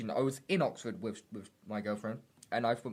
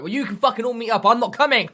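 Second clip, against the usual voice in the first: loud, higher, storming-off shout; angry outburst; 8 semitones higher, 10 dB louder than usual. Retold or imitated. Retold blow-up at friends over the cancelled night out, angry. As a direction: spit it out like you're walking away for good. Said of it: "I was so angry".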